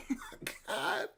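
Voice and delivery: soft, high laughing oh my God; breathless laugh of delight, slowed; laughing in disbelief. Real, not acted. Delighted reaction to comment.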